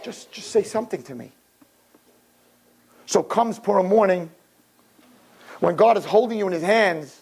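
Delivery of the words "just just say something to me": hushed plea. Acted. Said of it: "he holds us and he says".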